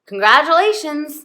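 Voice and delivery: loud, high announcement, imitated; booming congratulations. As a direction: burst in and proclaim it. Doctor bursting in, acted out.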